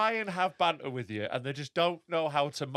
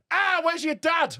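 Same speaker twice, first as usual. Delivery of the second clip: mock fan banter; 11 semitones higher, 4 dB louder, slower than usual. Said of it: "They'll be like".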